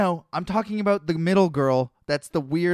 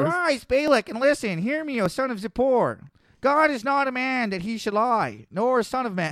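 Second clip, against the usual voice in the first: high-pitched raspy nerd voice; 8 semitones higher than usual. Acted. Imagining God as a cartoon voice, raspy nerd. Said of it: "like a raspy nerd".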